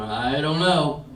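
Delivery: slow, drawn-out unsure mumble; sheepish doubt. Retold or imitated. Audience dads unsure about their kids, sheepish drawl. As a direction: drag it out like a guilty guy unsure. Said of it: "some of the guys going".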